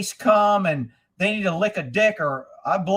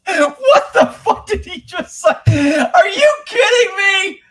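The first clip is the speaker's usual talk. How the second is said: much higher and louder, half-laughing shout of disbelief; outraged disbelief; 8 semitones higher, 7 dB louder, slower than usual. Real, not acted. Vlogger reacts to the president's rambling clip, outraged disbelief. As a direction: cry out like you can't believe your ears.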